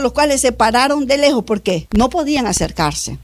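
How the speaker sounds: ordinary delivery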